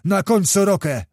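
commanding. A narrator's reading. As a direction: call out an urgent command. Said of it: "zakomenderował znowu Kmicic".

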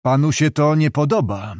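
usual speaking voice

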